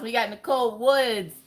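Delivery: usual speaking voice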